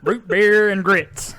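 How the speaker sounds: high, slow country holler; country accent. Pretend. Friend joins the joke, country accent. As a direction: stretch it out in a mock country accent. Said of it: "do it in a country accent".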